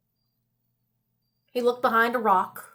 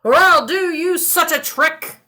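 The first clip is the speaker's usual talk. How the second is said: put-on threatening old man; 5 semitones higher, 5 dB louder than usual. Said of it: "cried the old man".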